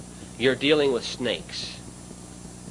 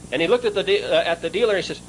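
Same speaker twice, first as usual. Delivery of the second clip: usual speaking voice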